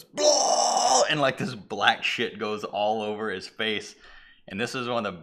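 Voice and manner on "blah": loud, high vomiting blurt; retching blurt. Retold or imitated. Possessed anchor puking on her co-host, loud blurt. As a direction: spew it out like puking.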